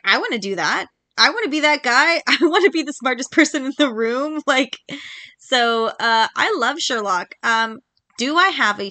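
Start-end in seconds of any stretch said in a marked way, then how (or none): 0.0-4.4 rising, louder and higher with a laugh; eager young fan. Retold or imitated. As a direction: gush like a kid who just found her idol. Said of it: "I was like".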